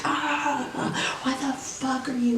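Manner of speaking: high, strained mock scream at normal volume; retold scream.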